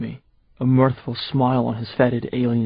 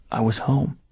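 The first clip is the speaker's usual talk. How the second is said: ordinary delivery, nothing marked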